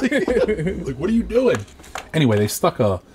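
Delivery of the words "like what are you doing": laughing, high disbelief. Real, not acted. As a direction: laugh through the question. Laughing at the doctors' stories.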